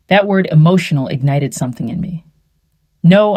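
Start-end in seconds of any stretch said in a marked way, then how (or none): 3.0-3.4 higher, louder, clear refusal; clear and firm. A narrator's reading. Wife rejecting the lawyer's word emotional, clear and firm. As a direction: say it out clearly, with force. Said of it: "I said clearly".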